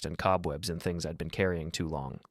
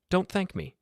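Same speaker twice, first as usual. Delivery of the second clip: woman's voice; 11 semitones higher than usual. Acted. Helen's voice.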